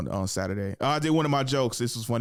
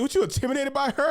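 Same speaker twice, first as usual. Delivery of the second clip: high-pitched teasing challenge; mock challenge; 12 semitones higher, 3 dB louder, faster than usual. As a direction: needle him with your voice pitched way up. Comic retelling of teasing a guy.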